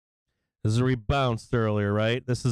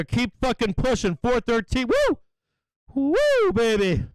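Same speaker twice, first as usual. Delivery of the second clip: excited whooping; 9 semitones higher than usual. Real, not acted. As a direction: cheer the rally like a win. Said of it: "I'm all excited here".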